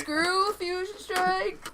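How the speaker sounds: playful slow scorn; mock dismissive. Real, not acted. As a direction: wave it off playfully. Mock dismissing card set.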